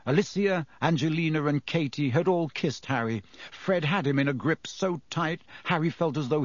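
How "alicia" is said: plain reading voice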